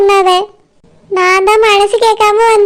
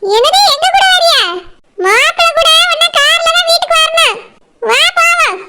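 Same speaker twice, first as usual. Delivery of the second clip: high-pitched, incredulous maternal reproof; disapproving mother; 8 semitones higher than usual. Acted. Mother to bride wanting to ride along, disapproving. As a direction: refuse her in a high, firm voice. Mother refuses daughter's plea.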